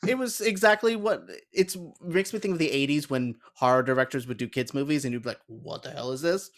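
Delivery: ordinary delivery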